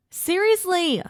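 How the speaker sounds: high squeaked protest; hoisted over his shoulder, she squeaks in protest. Acted. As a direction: squeak it out in alarm. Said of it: "I squeaked".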